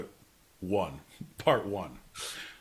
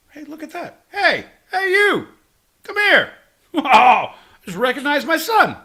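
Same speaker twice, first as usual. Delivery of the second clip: calling out; 11 semitones higher, 9 dB louder than usual. Pretend. Calling out in a crowd.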